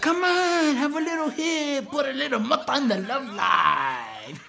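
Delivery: coaxing partier. Retold or imitated. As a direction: coax like a pushy stoned partner. Acted drunk Bob coaxing Rosie.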